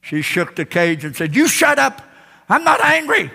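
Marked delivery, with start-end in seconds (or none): 1.3-3.3 higher and louder, huffy; mock angry retort. Woman shaking the cage at the parrot, mock-angry. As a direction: snap back, pretending calm.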